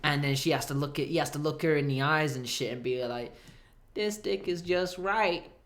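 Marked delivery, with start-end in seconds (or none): 3.9-5.5 slow, earnest mock-solemn verdict. Goldilocks captive gravely judging the third one, mock-solemn. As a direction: deliver it like a grave pronouncement.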